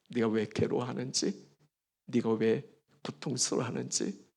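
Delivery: soft, hushed, tender words of comfort; tender and loving. Pretend. Jesus to the broken Peter, soft and tender. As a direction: speak gently to a friend who failed you. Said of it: "변함없는 사랑으로".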